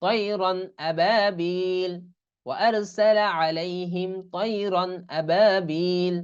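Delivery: slow, stretched "tayran ababil"; clear tanween before hamza. Shown as a demonstration. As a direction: recite "tayran ababil" with long vowels. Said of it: "এর উচ্চারণ কেমন হবে". ("তয়রন আবাবিল" টেনে স্পষ্ট পড়া)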